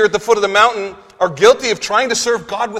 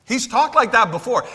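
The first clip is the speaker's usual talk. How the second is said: usual speaking voice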